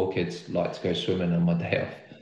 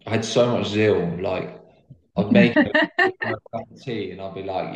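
ordinary talk

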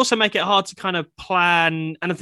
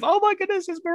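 much higher, gushing fanboy squeal; mock starstruck fanboy. Interviewer teasing the singer, mimicking a gushing starstruck fanboy. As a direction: play the giddy fan meeting his idol. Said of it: "like, the kind of fanboy of".

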